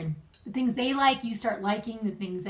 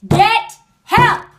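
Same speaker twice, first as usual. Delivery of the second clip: sudden loud, high yell; mock yell; 10 semitones higher, 14 dB louder than usual. Retelling mother's two words of wisdom, mock yell. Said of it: "she yelled".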